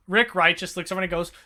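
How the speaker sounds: usual speaking voice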